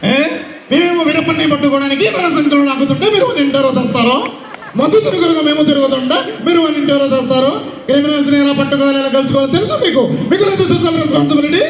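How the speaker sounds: hammed-up police officer rattling off a grievance; Sayaji Shinde. Mimic replaying a police officer's movie dialogue, hammed-up rant. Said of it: "Pokiri సినిమాలో శివాజీ షిండే గారి voice అండి".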